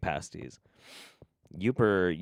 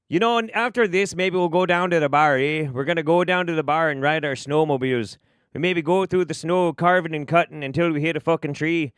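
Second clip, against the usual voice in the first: higher, hammed-up Yooper yarn; Yooper accent; 5 semitones higher, 3 dB louder than usual. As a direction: ramble along like a proud Yooper. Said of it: "ruined my Yooper accent".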